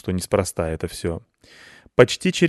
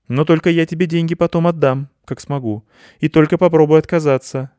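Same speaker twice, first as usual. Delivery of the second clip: insistent; 8 semitones higher, 6 dB louder than usual. A narrator's reading. Girl insists on repaying money.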